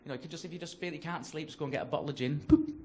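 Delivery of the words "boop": short imitated till beep; scanner beep. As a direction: mimic the checkout scanner's blip. Imitates self-checkout scanner beep.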